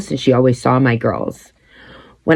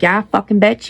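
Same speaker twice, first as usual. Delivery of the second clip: mock snapping back; 7 semitones higher than usual. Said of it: "trying not to be like".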